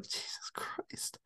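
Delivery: slow whispered awe. Retold or imitated. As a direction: breathe it out in a whisper. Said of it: "And I was like".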